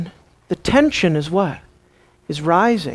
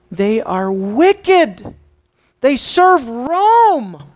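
slow, drawn-out outrage, climbing high; scornful denunciation; 5 semitones higher, 4 dB louder, much slower than usual. Acted. Preacher voicing contempt for tax collectors, slow outrage. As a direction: stretch out each damning word.

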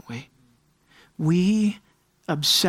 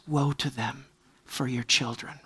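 hushed lament; 7 semitones lower, 8 dB quieter than usual. Acted. God's lament voiced softly.

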